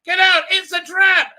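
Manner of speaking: hammed-up Doctor warning; urgent warning shout. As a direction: bellow the alarm at them.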